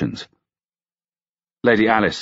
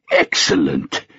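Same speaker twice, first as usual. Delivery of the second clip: lower, settled repeat; low pleased echo; 5 semitones lower, slower than usual. A narrator's reading. Old king settling into satisfaction, lower. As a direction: say it again down and content. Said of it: "nodding in delight".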